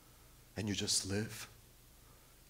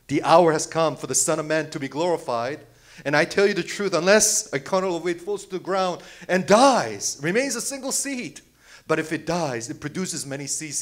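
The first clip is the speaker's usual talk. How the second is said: higher and louder scripture proclamation; proclaiming loudly; 5 semitones higher, 6 dB louder than usual. Real, not acted. Preacher reading Jesus' words to congregation, loud. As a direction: proclaim the verse with fire.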